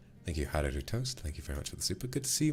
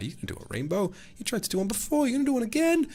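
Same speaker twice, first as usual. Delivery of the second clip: very high, overexcited squeal; squeaky mock amazement; 3 dB louder than usual. Streamer to viewer sending another rainbow gift, squeaky amazement. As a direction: play it aghast in a tiny squeaky voice.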